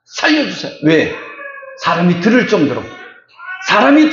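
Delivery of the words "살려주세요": cry for help. Shown as a demonstration. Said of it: "하고 고함을 치는 거예요".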